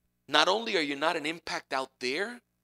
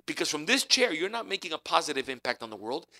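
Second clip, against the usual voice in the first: normal talking voice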